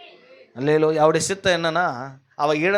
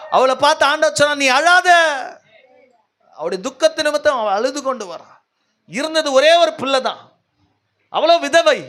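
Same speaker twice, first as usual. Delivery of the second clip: high, driving sermon delivery; impassioned preaching; 10 semitones higher, 5 dB louder than usual. Real, not acted. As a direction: build one long urgent appeal to the congregation. (உணர்ச்சி பொங்கும் உரத்த பிரசங்கம்)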